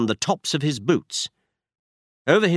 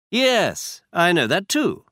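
a keen detective, pitched higher; brisk, sure Holmes explaining a clue; Holmes, lightly voiced; 7 semitones higher, slower than usual.

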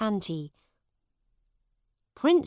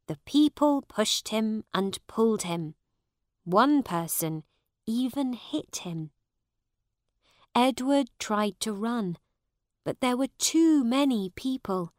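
ordinary delivery

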